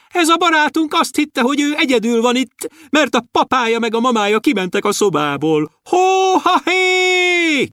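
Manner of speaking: tiny dwarf hollering happily; shouting dwarf. A narrator's reading. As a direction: call gleefully to the stars. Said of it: "kiabálta".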